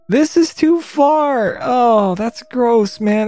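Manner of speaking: high-pitched, drawn-out disgust; appalled. Real, not acted. Streamer reacting to a gross image, high-pitched dismay.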